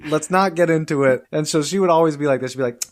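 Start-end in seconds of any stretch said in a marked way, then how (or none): 0.1-1.2 higher, louder, mock-impatient teacher; imitated exasperated teacher. Teacher to a student pestering her with questions, exasperated. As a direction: cut off a pestering student, playfully raised voice. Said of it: "I would always pester her with questions".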